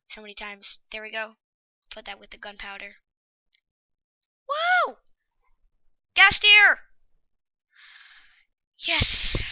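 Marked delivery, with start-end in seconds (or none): 4.5-9.4 loud, high whoops of joy; ecstatic cheer. Real, not acted. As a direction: cheer like you just won big. Got the ghast tear.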